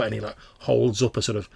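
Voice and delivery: normal talking voice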